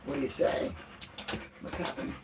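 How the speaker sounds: whispered questions to a dog; whispering. Real, not acted. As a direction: breathe it softly to the dog. Softly asking dog.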